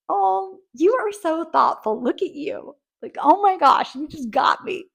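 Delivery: high, loud, gushing delight; playful enthusiasm. Shown as a demonstration. Praising a thoughtful friend, a high, loud playful gush. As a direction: squeal it out like a thrilled friend. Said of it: "embrace with playful enthusiasm".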